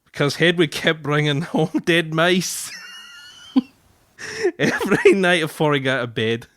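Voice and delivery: broad Scots, cracking up with laughter; Scottish accent. Shown as a demonstration. Husband reading about the owl's dead mice, laughing Scottish accent. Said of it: "putting on the Scottish accent".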